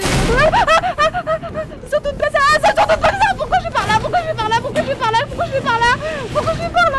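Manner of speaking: high, loud frantic panic; panicked. Real, not acted. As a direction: cry out in panic while running. Panicked running, repeated questions.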